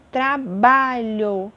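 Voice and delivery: tra-BA-lho, very slow, loud middle; stress on penultimate syllable. Shown as a demonstration. Teacher giving a paroxítona example, "ba" of "trabalho" stressed. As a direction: stretch "trabalho" and punch "ba". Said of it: "A minha penúltima sílaba, que é a mais forte".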